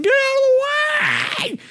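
high, slow hollered warning; mock yell. Rollerblader shouts at people, high mock yell.

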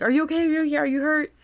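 high, rapid-fire worried questions; fussing concern. Acted. Lola with hands everywhere on Ren, worried and high-pitched. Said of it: "hands everywhere, just going like".

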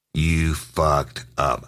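very slow, word by word; slow menace. Retold or imitated. Officer giving suspect crazy-eyed look, slow menacing delivery. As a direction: let each word land like a verdict.

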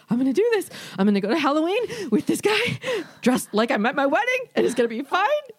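high, bright self-hype; pumped-up high quote; excited. Retold or imitated. Quoting her past excited self.

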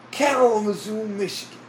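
put-on high Brooklyn accent; thick Brooklyn old guy voice. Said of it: "very stereotypical Brooklyn accent".

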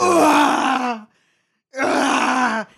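anguished scream. Acted. Anakin burning on lava banks.

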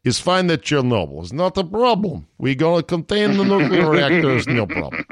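hammed-up Russian accent. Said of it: "do a good Russian accent".

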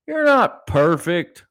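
ordinary talk

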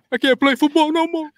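high, whiny imitation of a crying player; hammed-up tearful whine; mock crying. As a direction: whimper it like a teary movie scene. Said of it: "When he starts crying, he's like".

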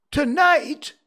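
high, loud startled cry; alarmed cry. Acted. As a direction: cry it out in shock. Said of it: "cried Scrooge".

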